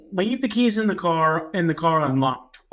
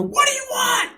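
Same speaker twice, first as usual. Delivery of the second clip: high, frantic yell through the door; panicked high-pitched shout at an intruder; scream. Retold or imitated. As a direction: scream it in alarm, pitch shooting way up. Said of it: "I scream".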